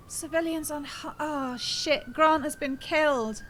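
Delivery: dismayed. Real, not acted. Streamer learns Grant has been killed, dismayed. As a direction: break off reading, dismayed.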